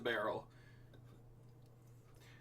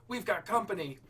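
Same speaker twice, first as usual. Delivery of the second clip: yelling; 6 semitones higher than usual. Acted. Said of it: "Petey's voice yelled".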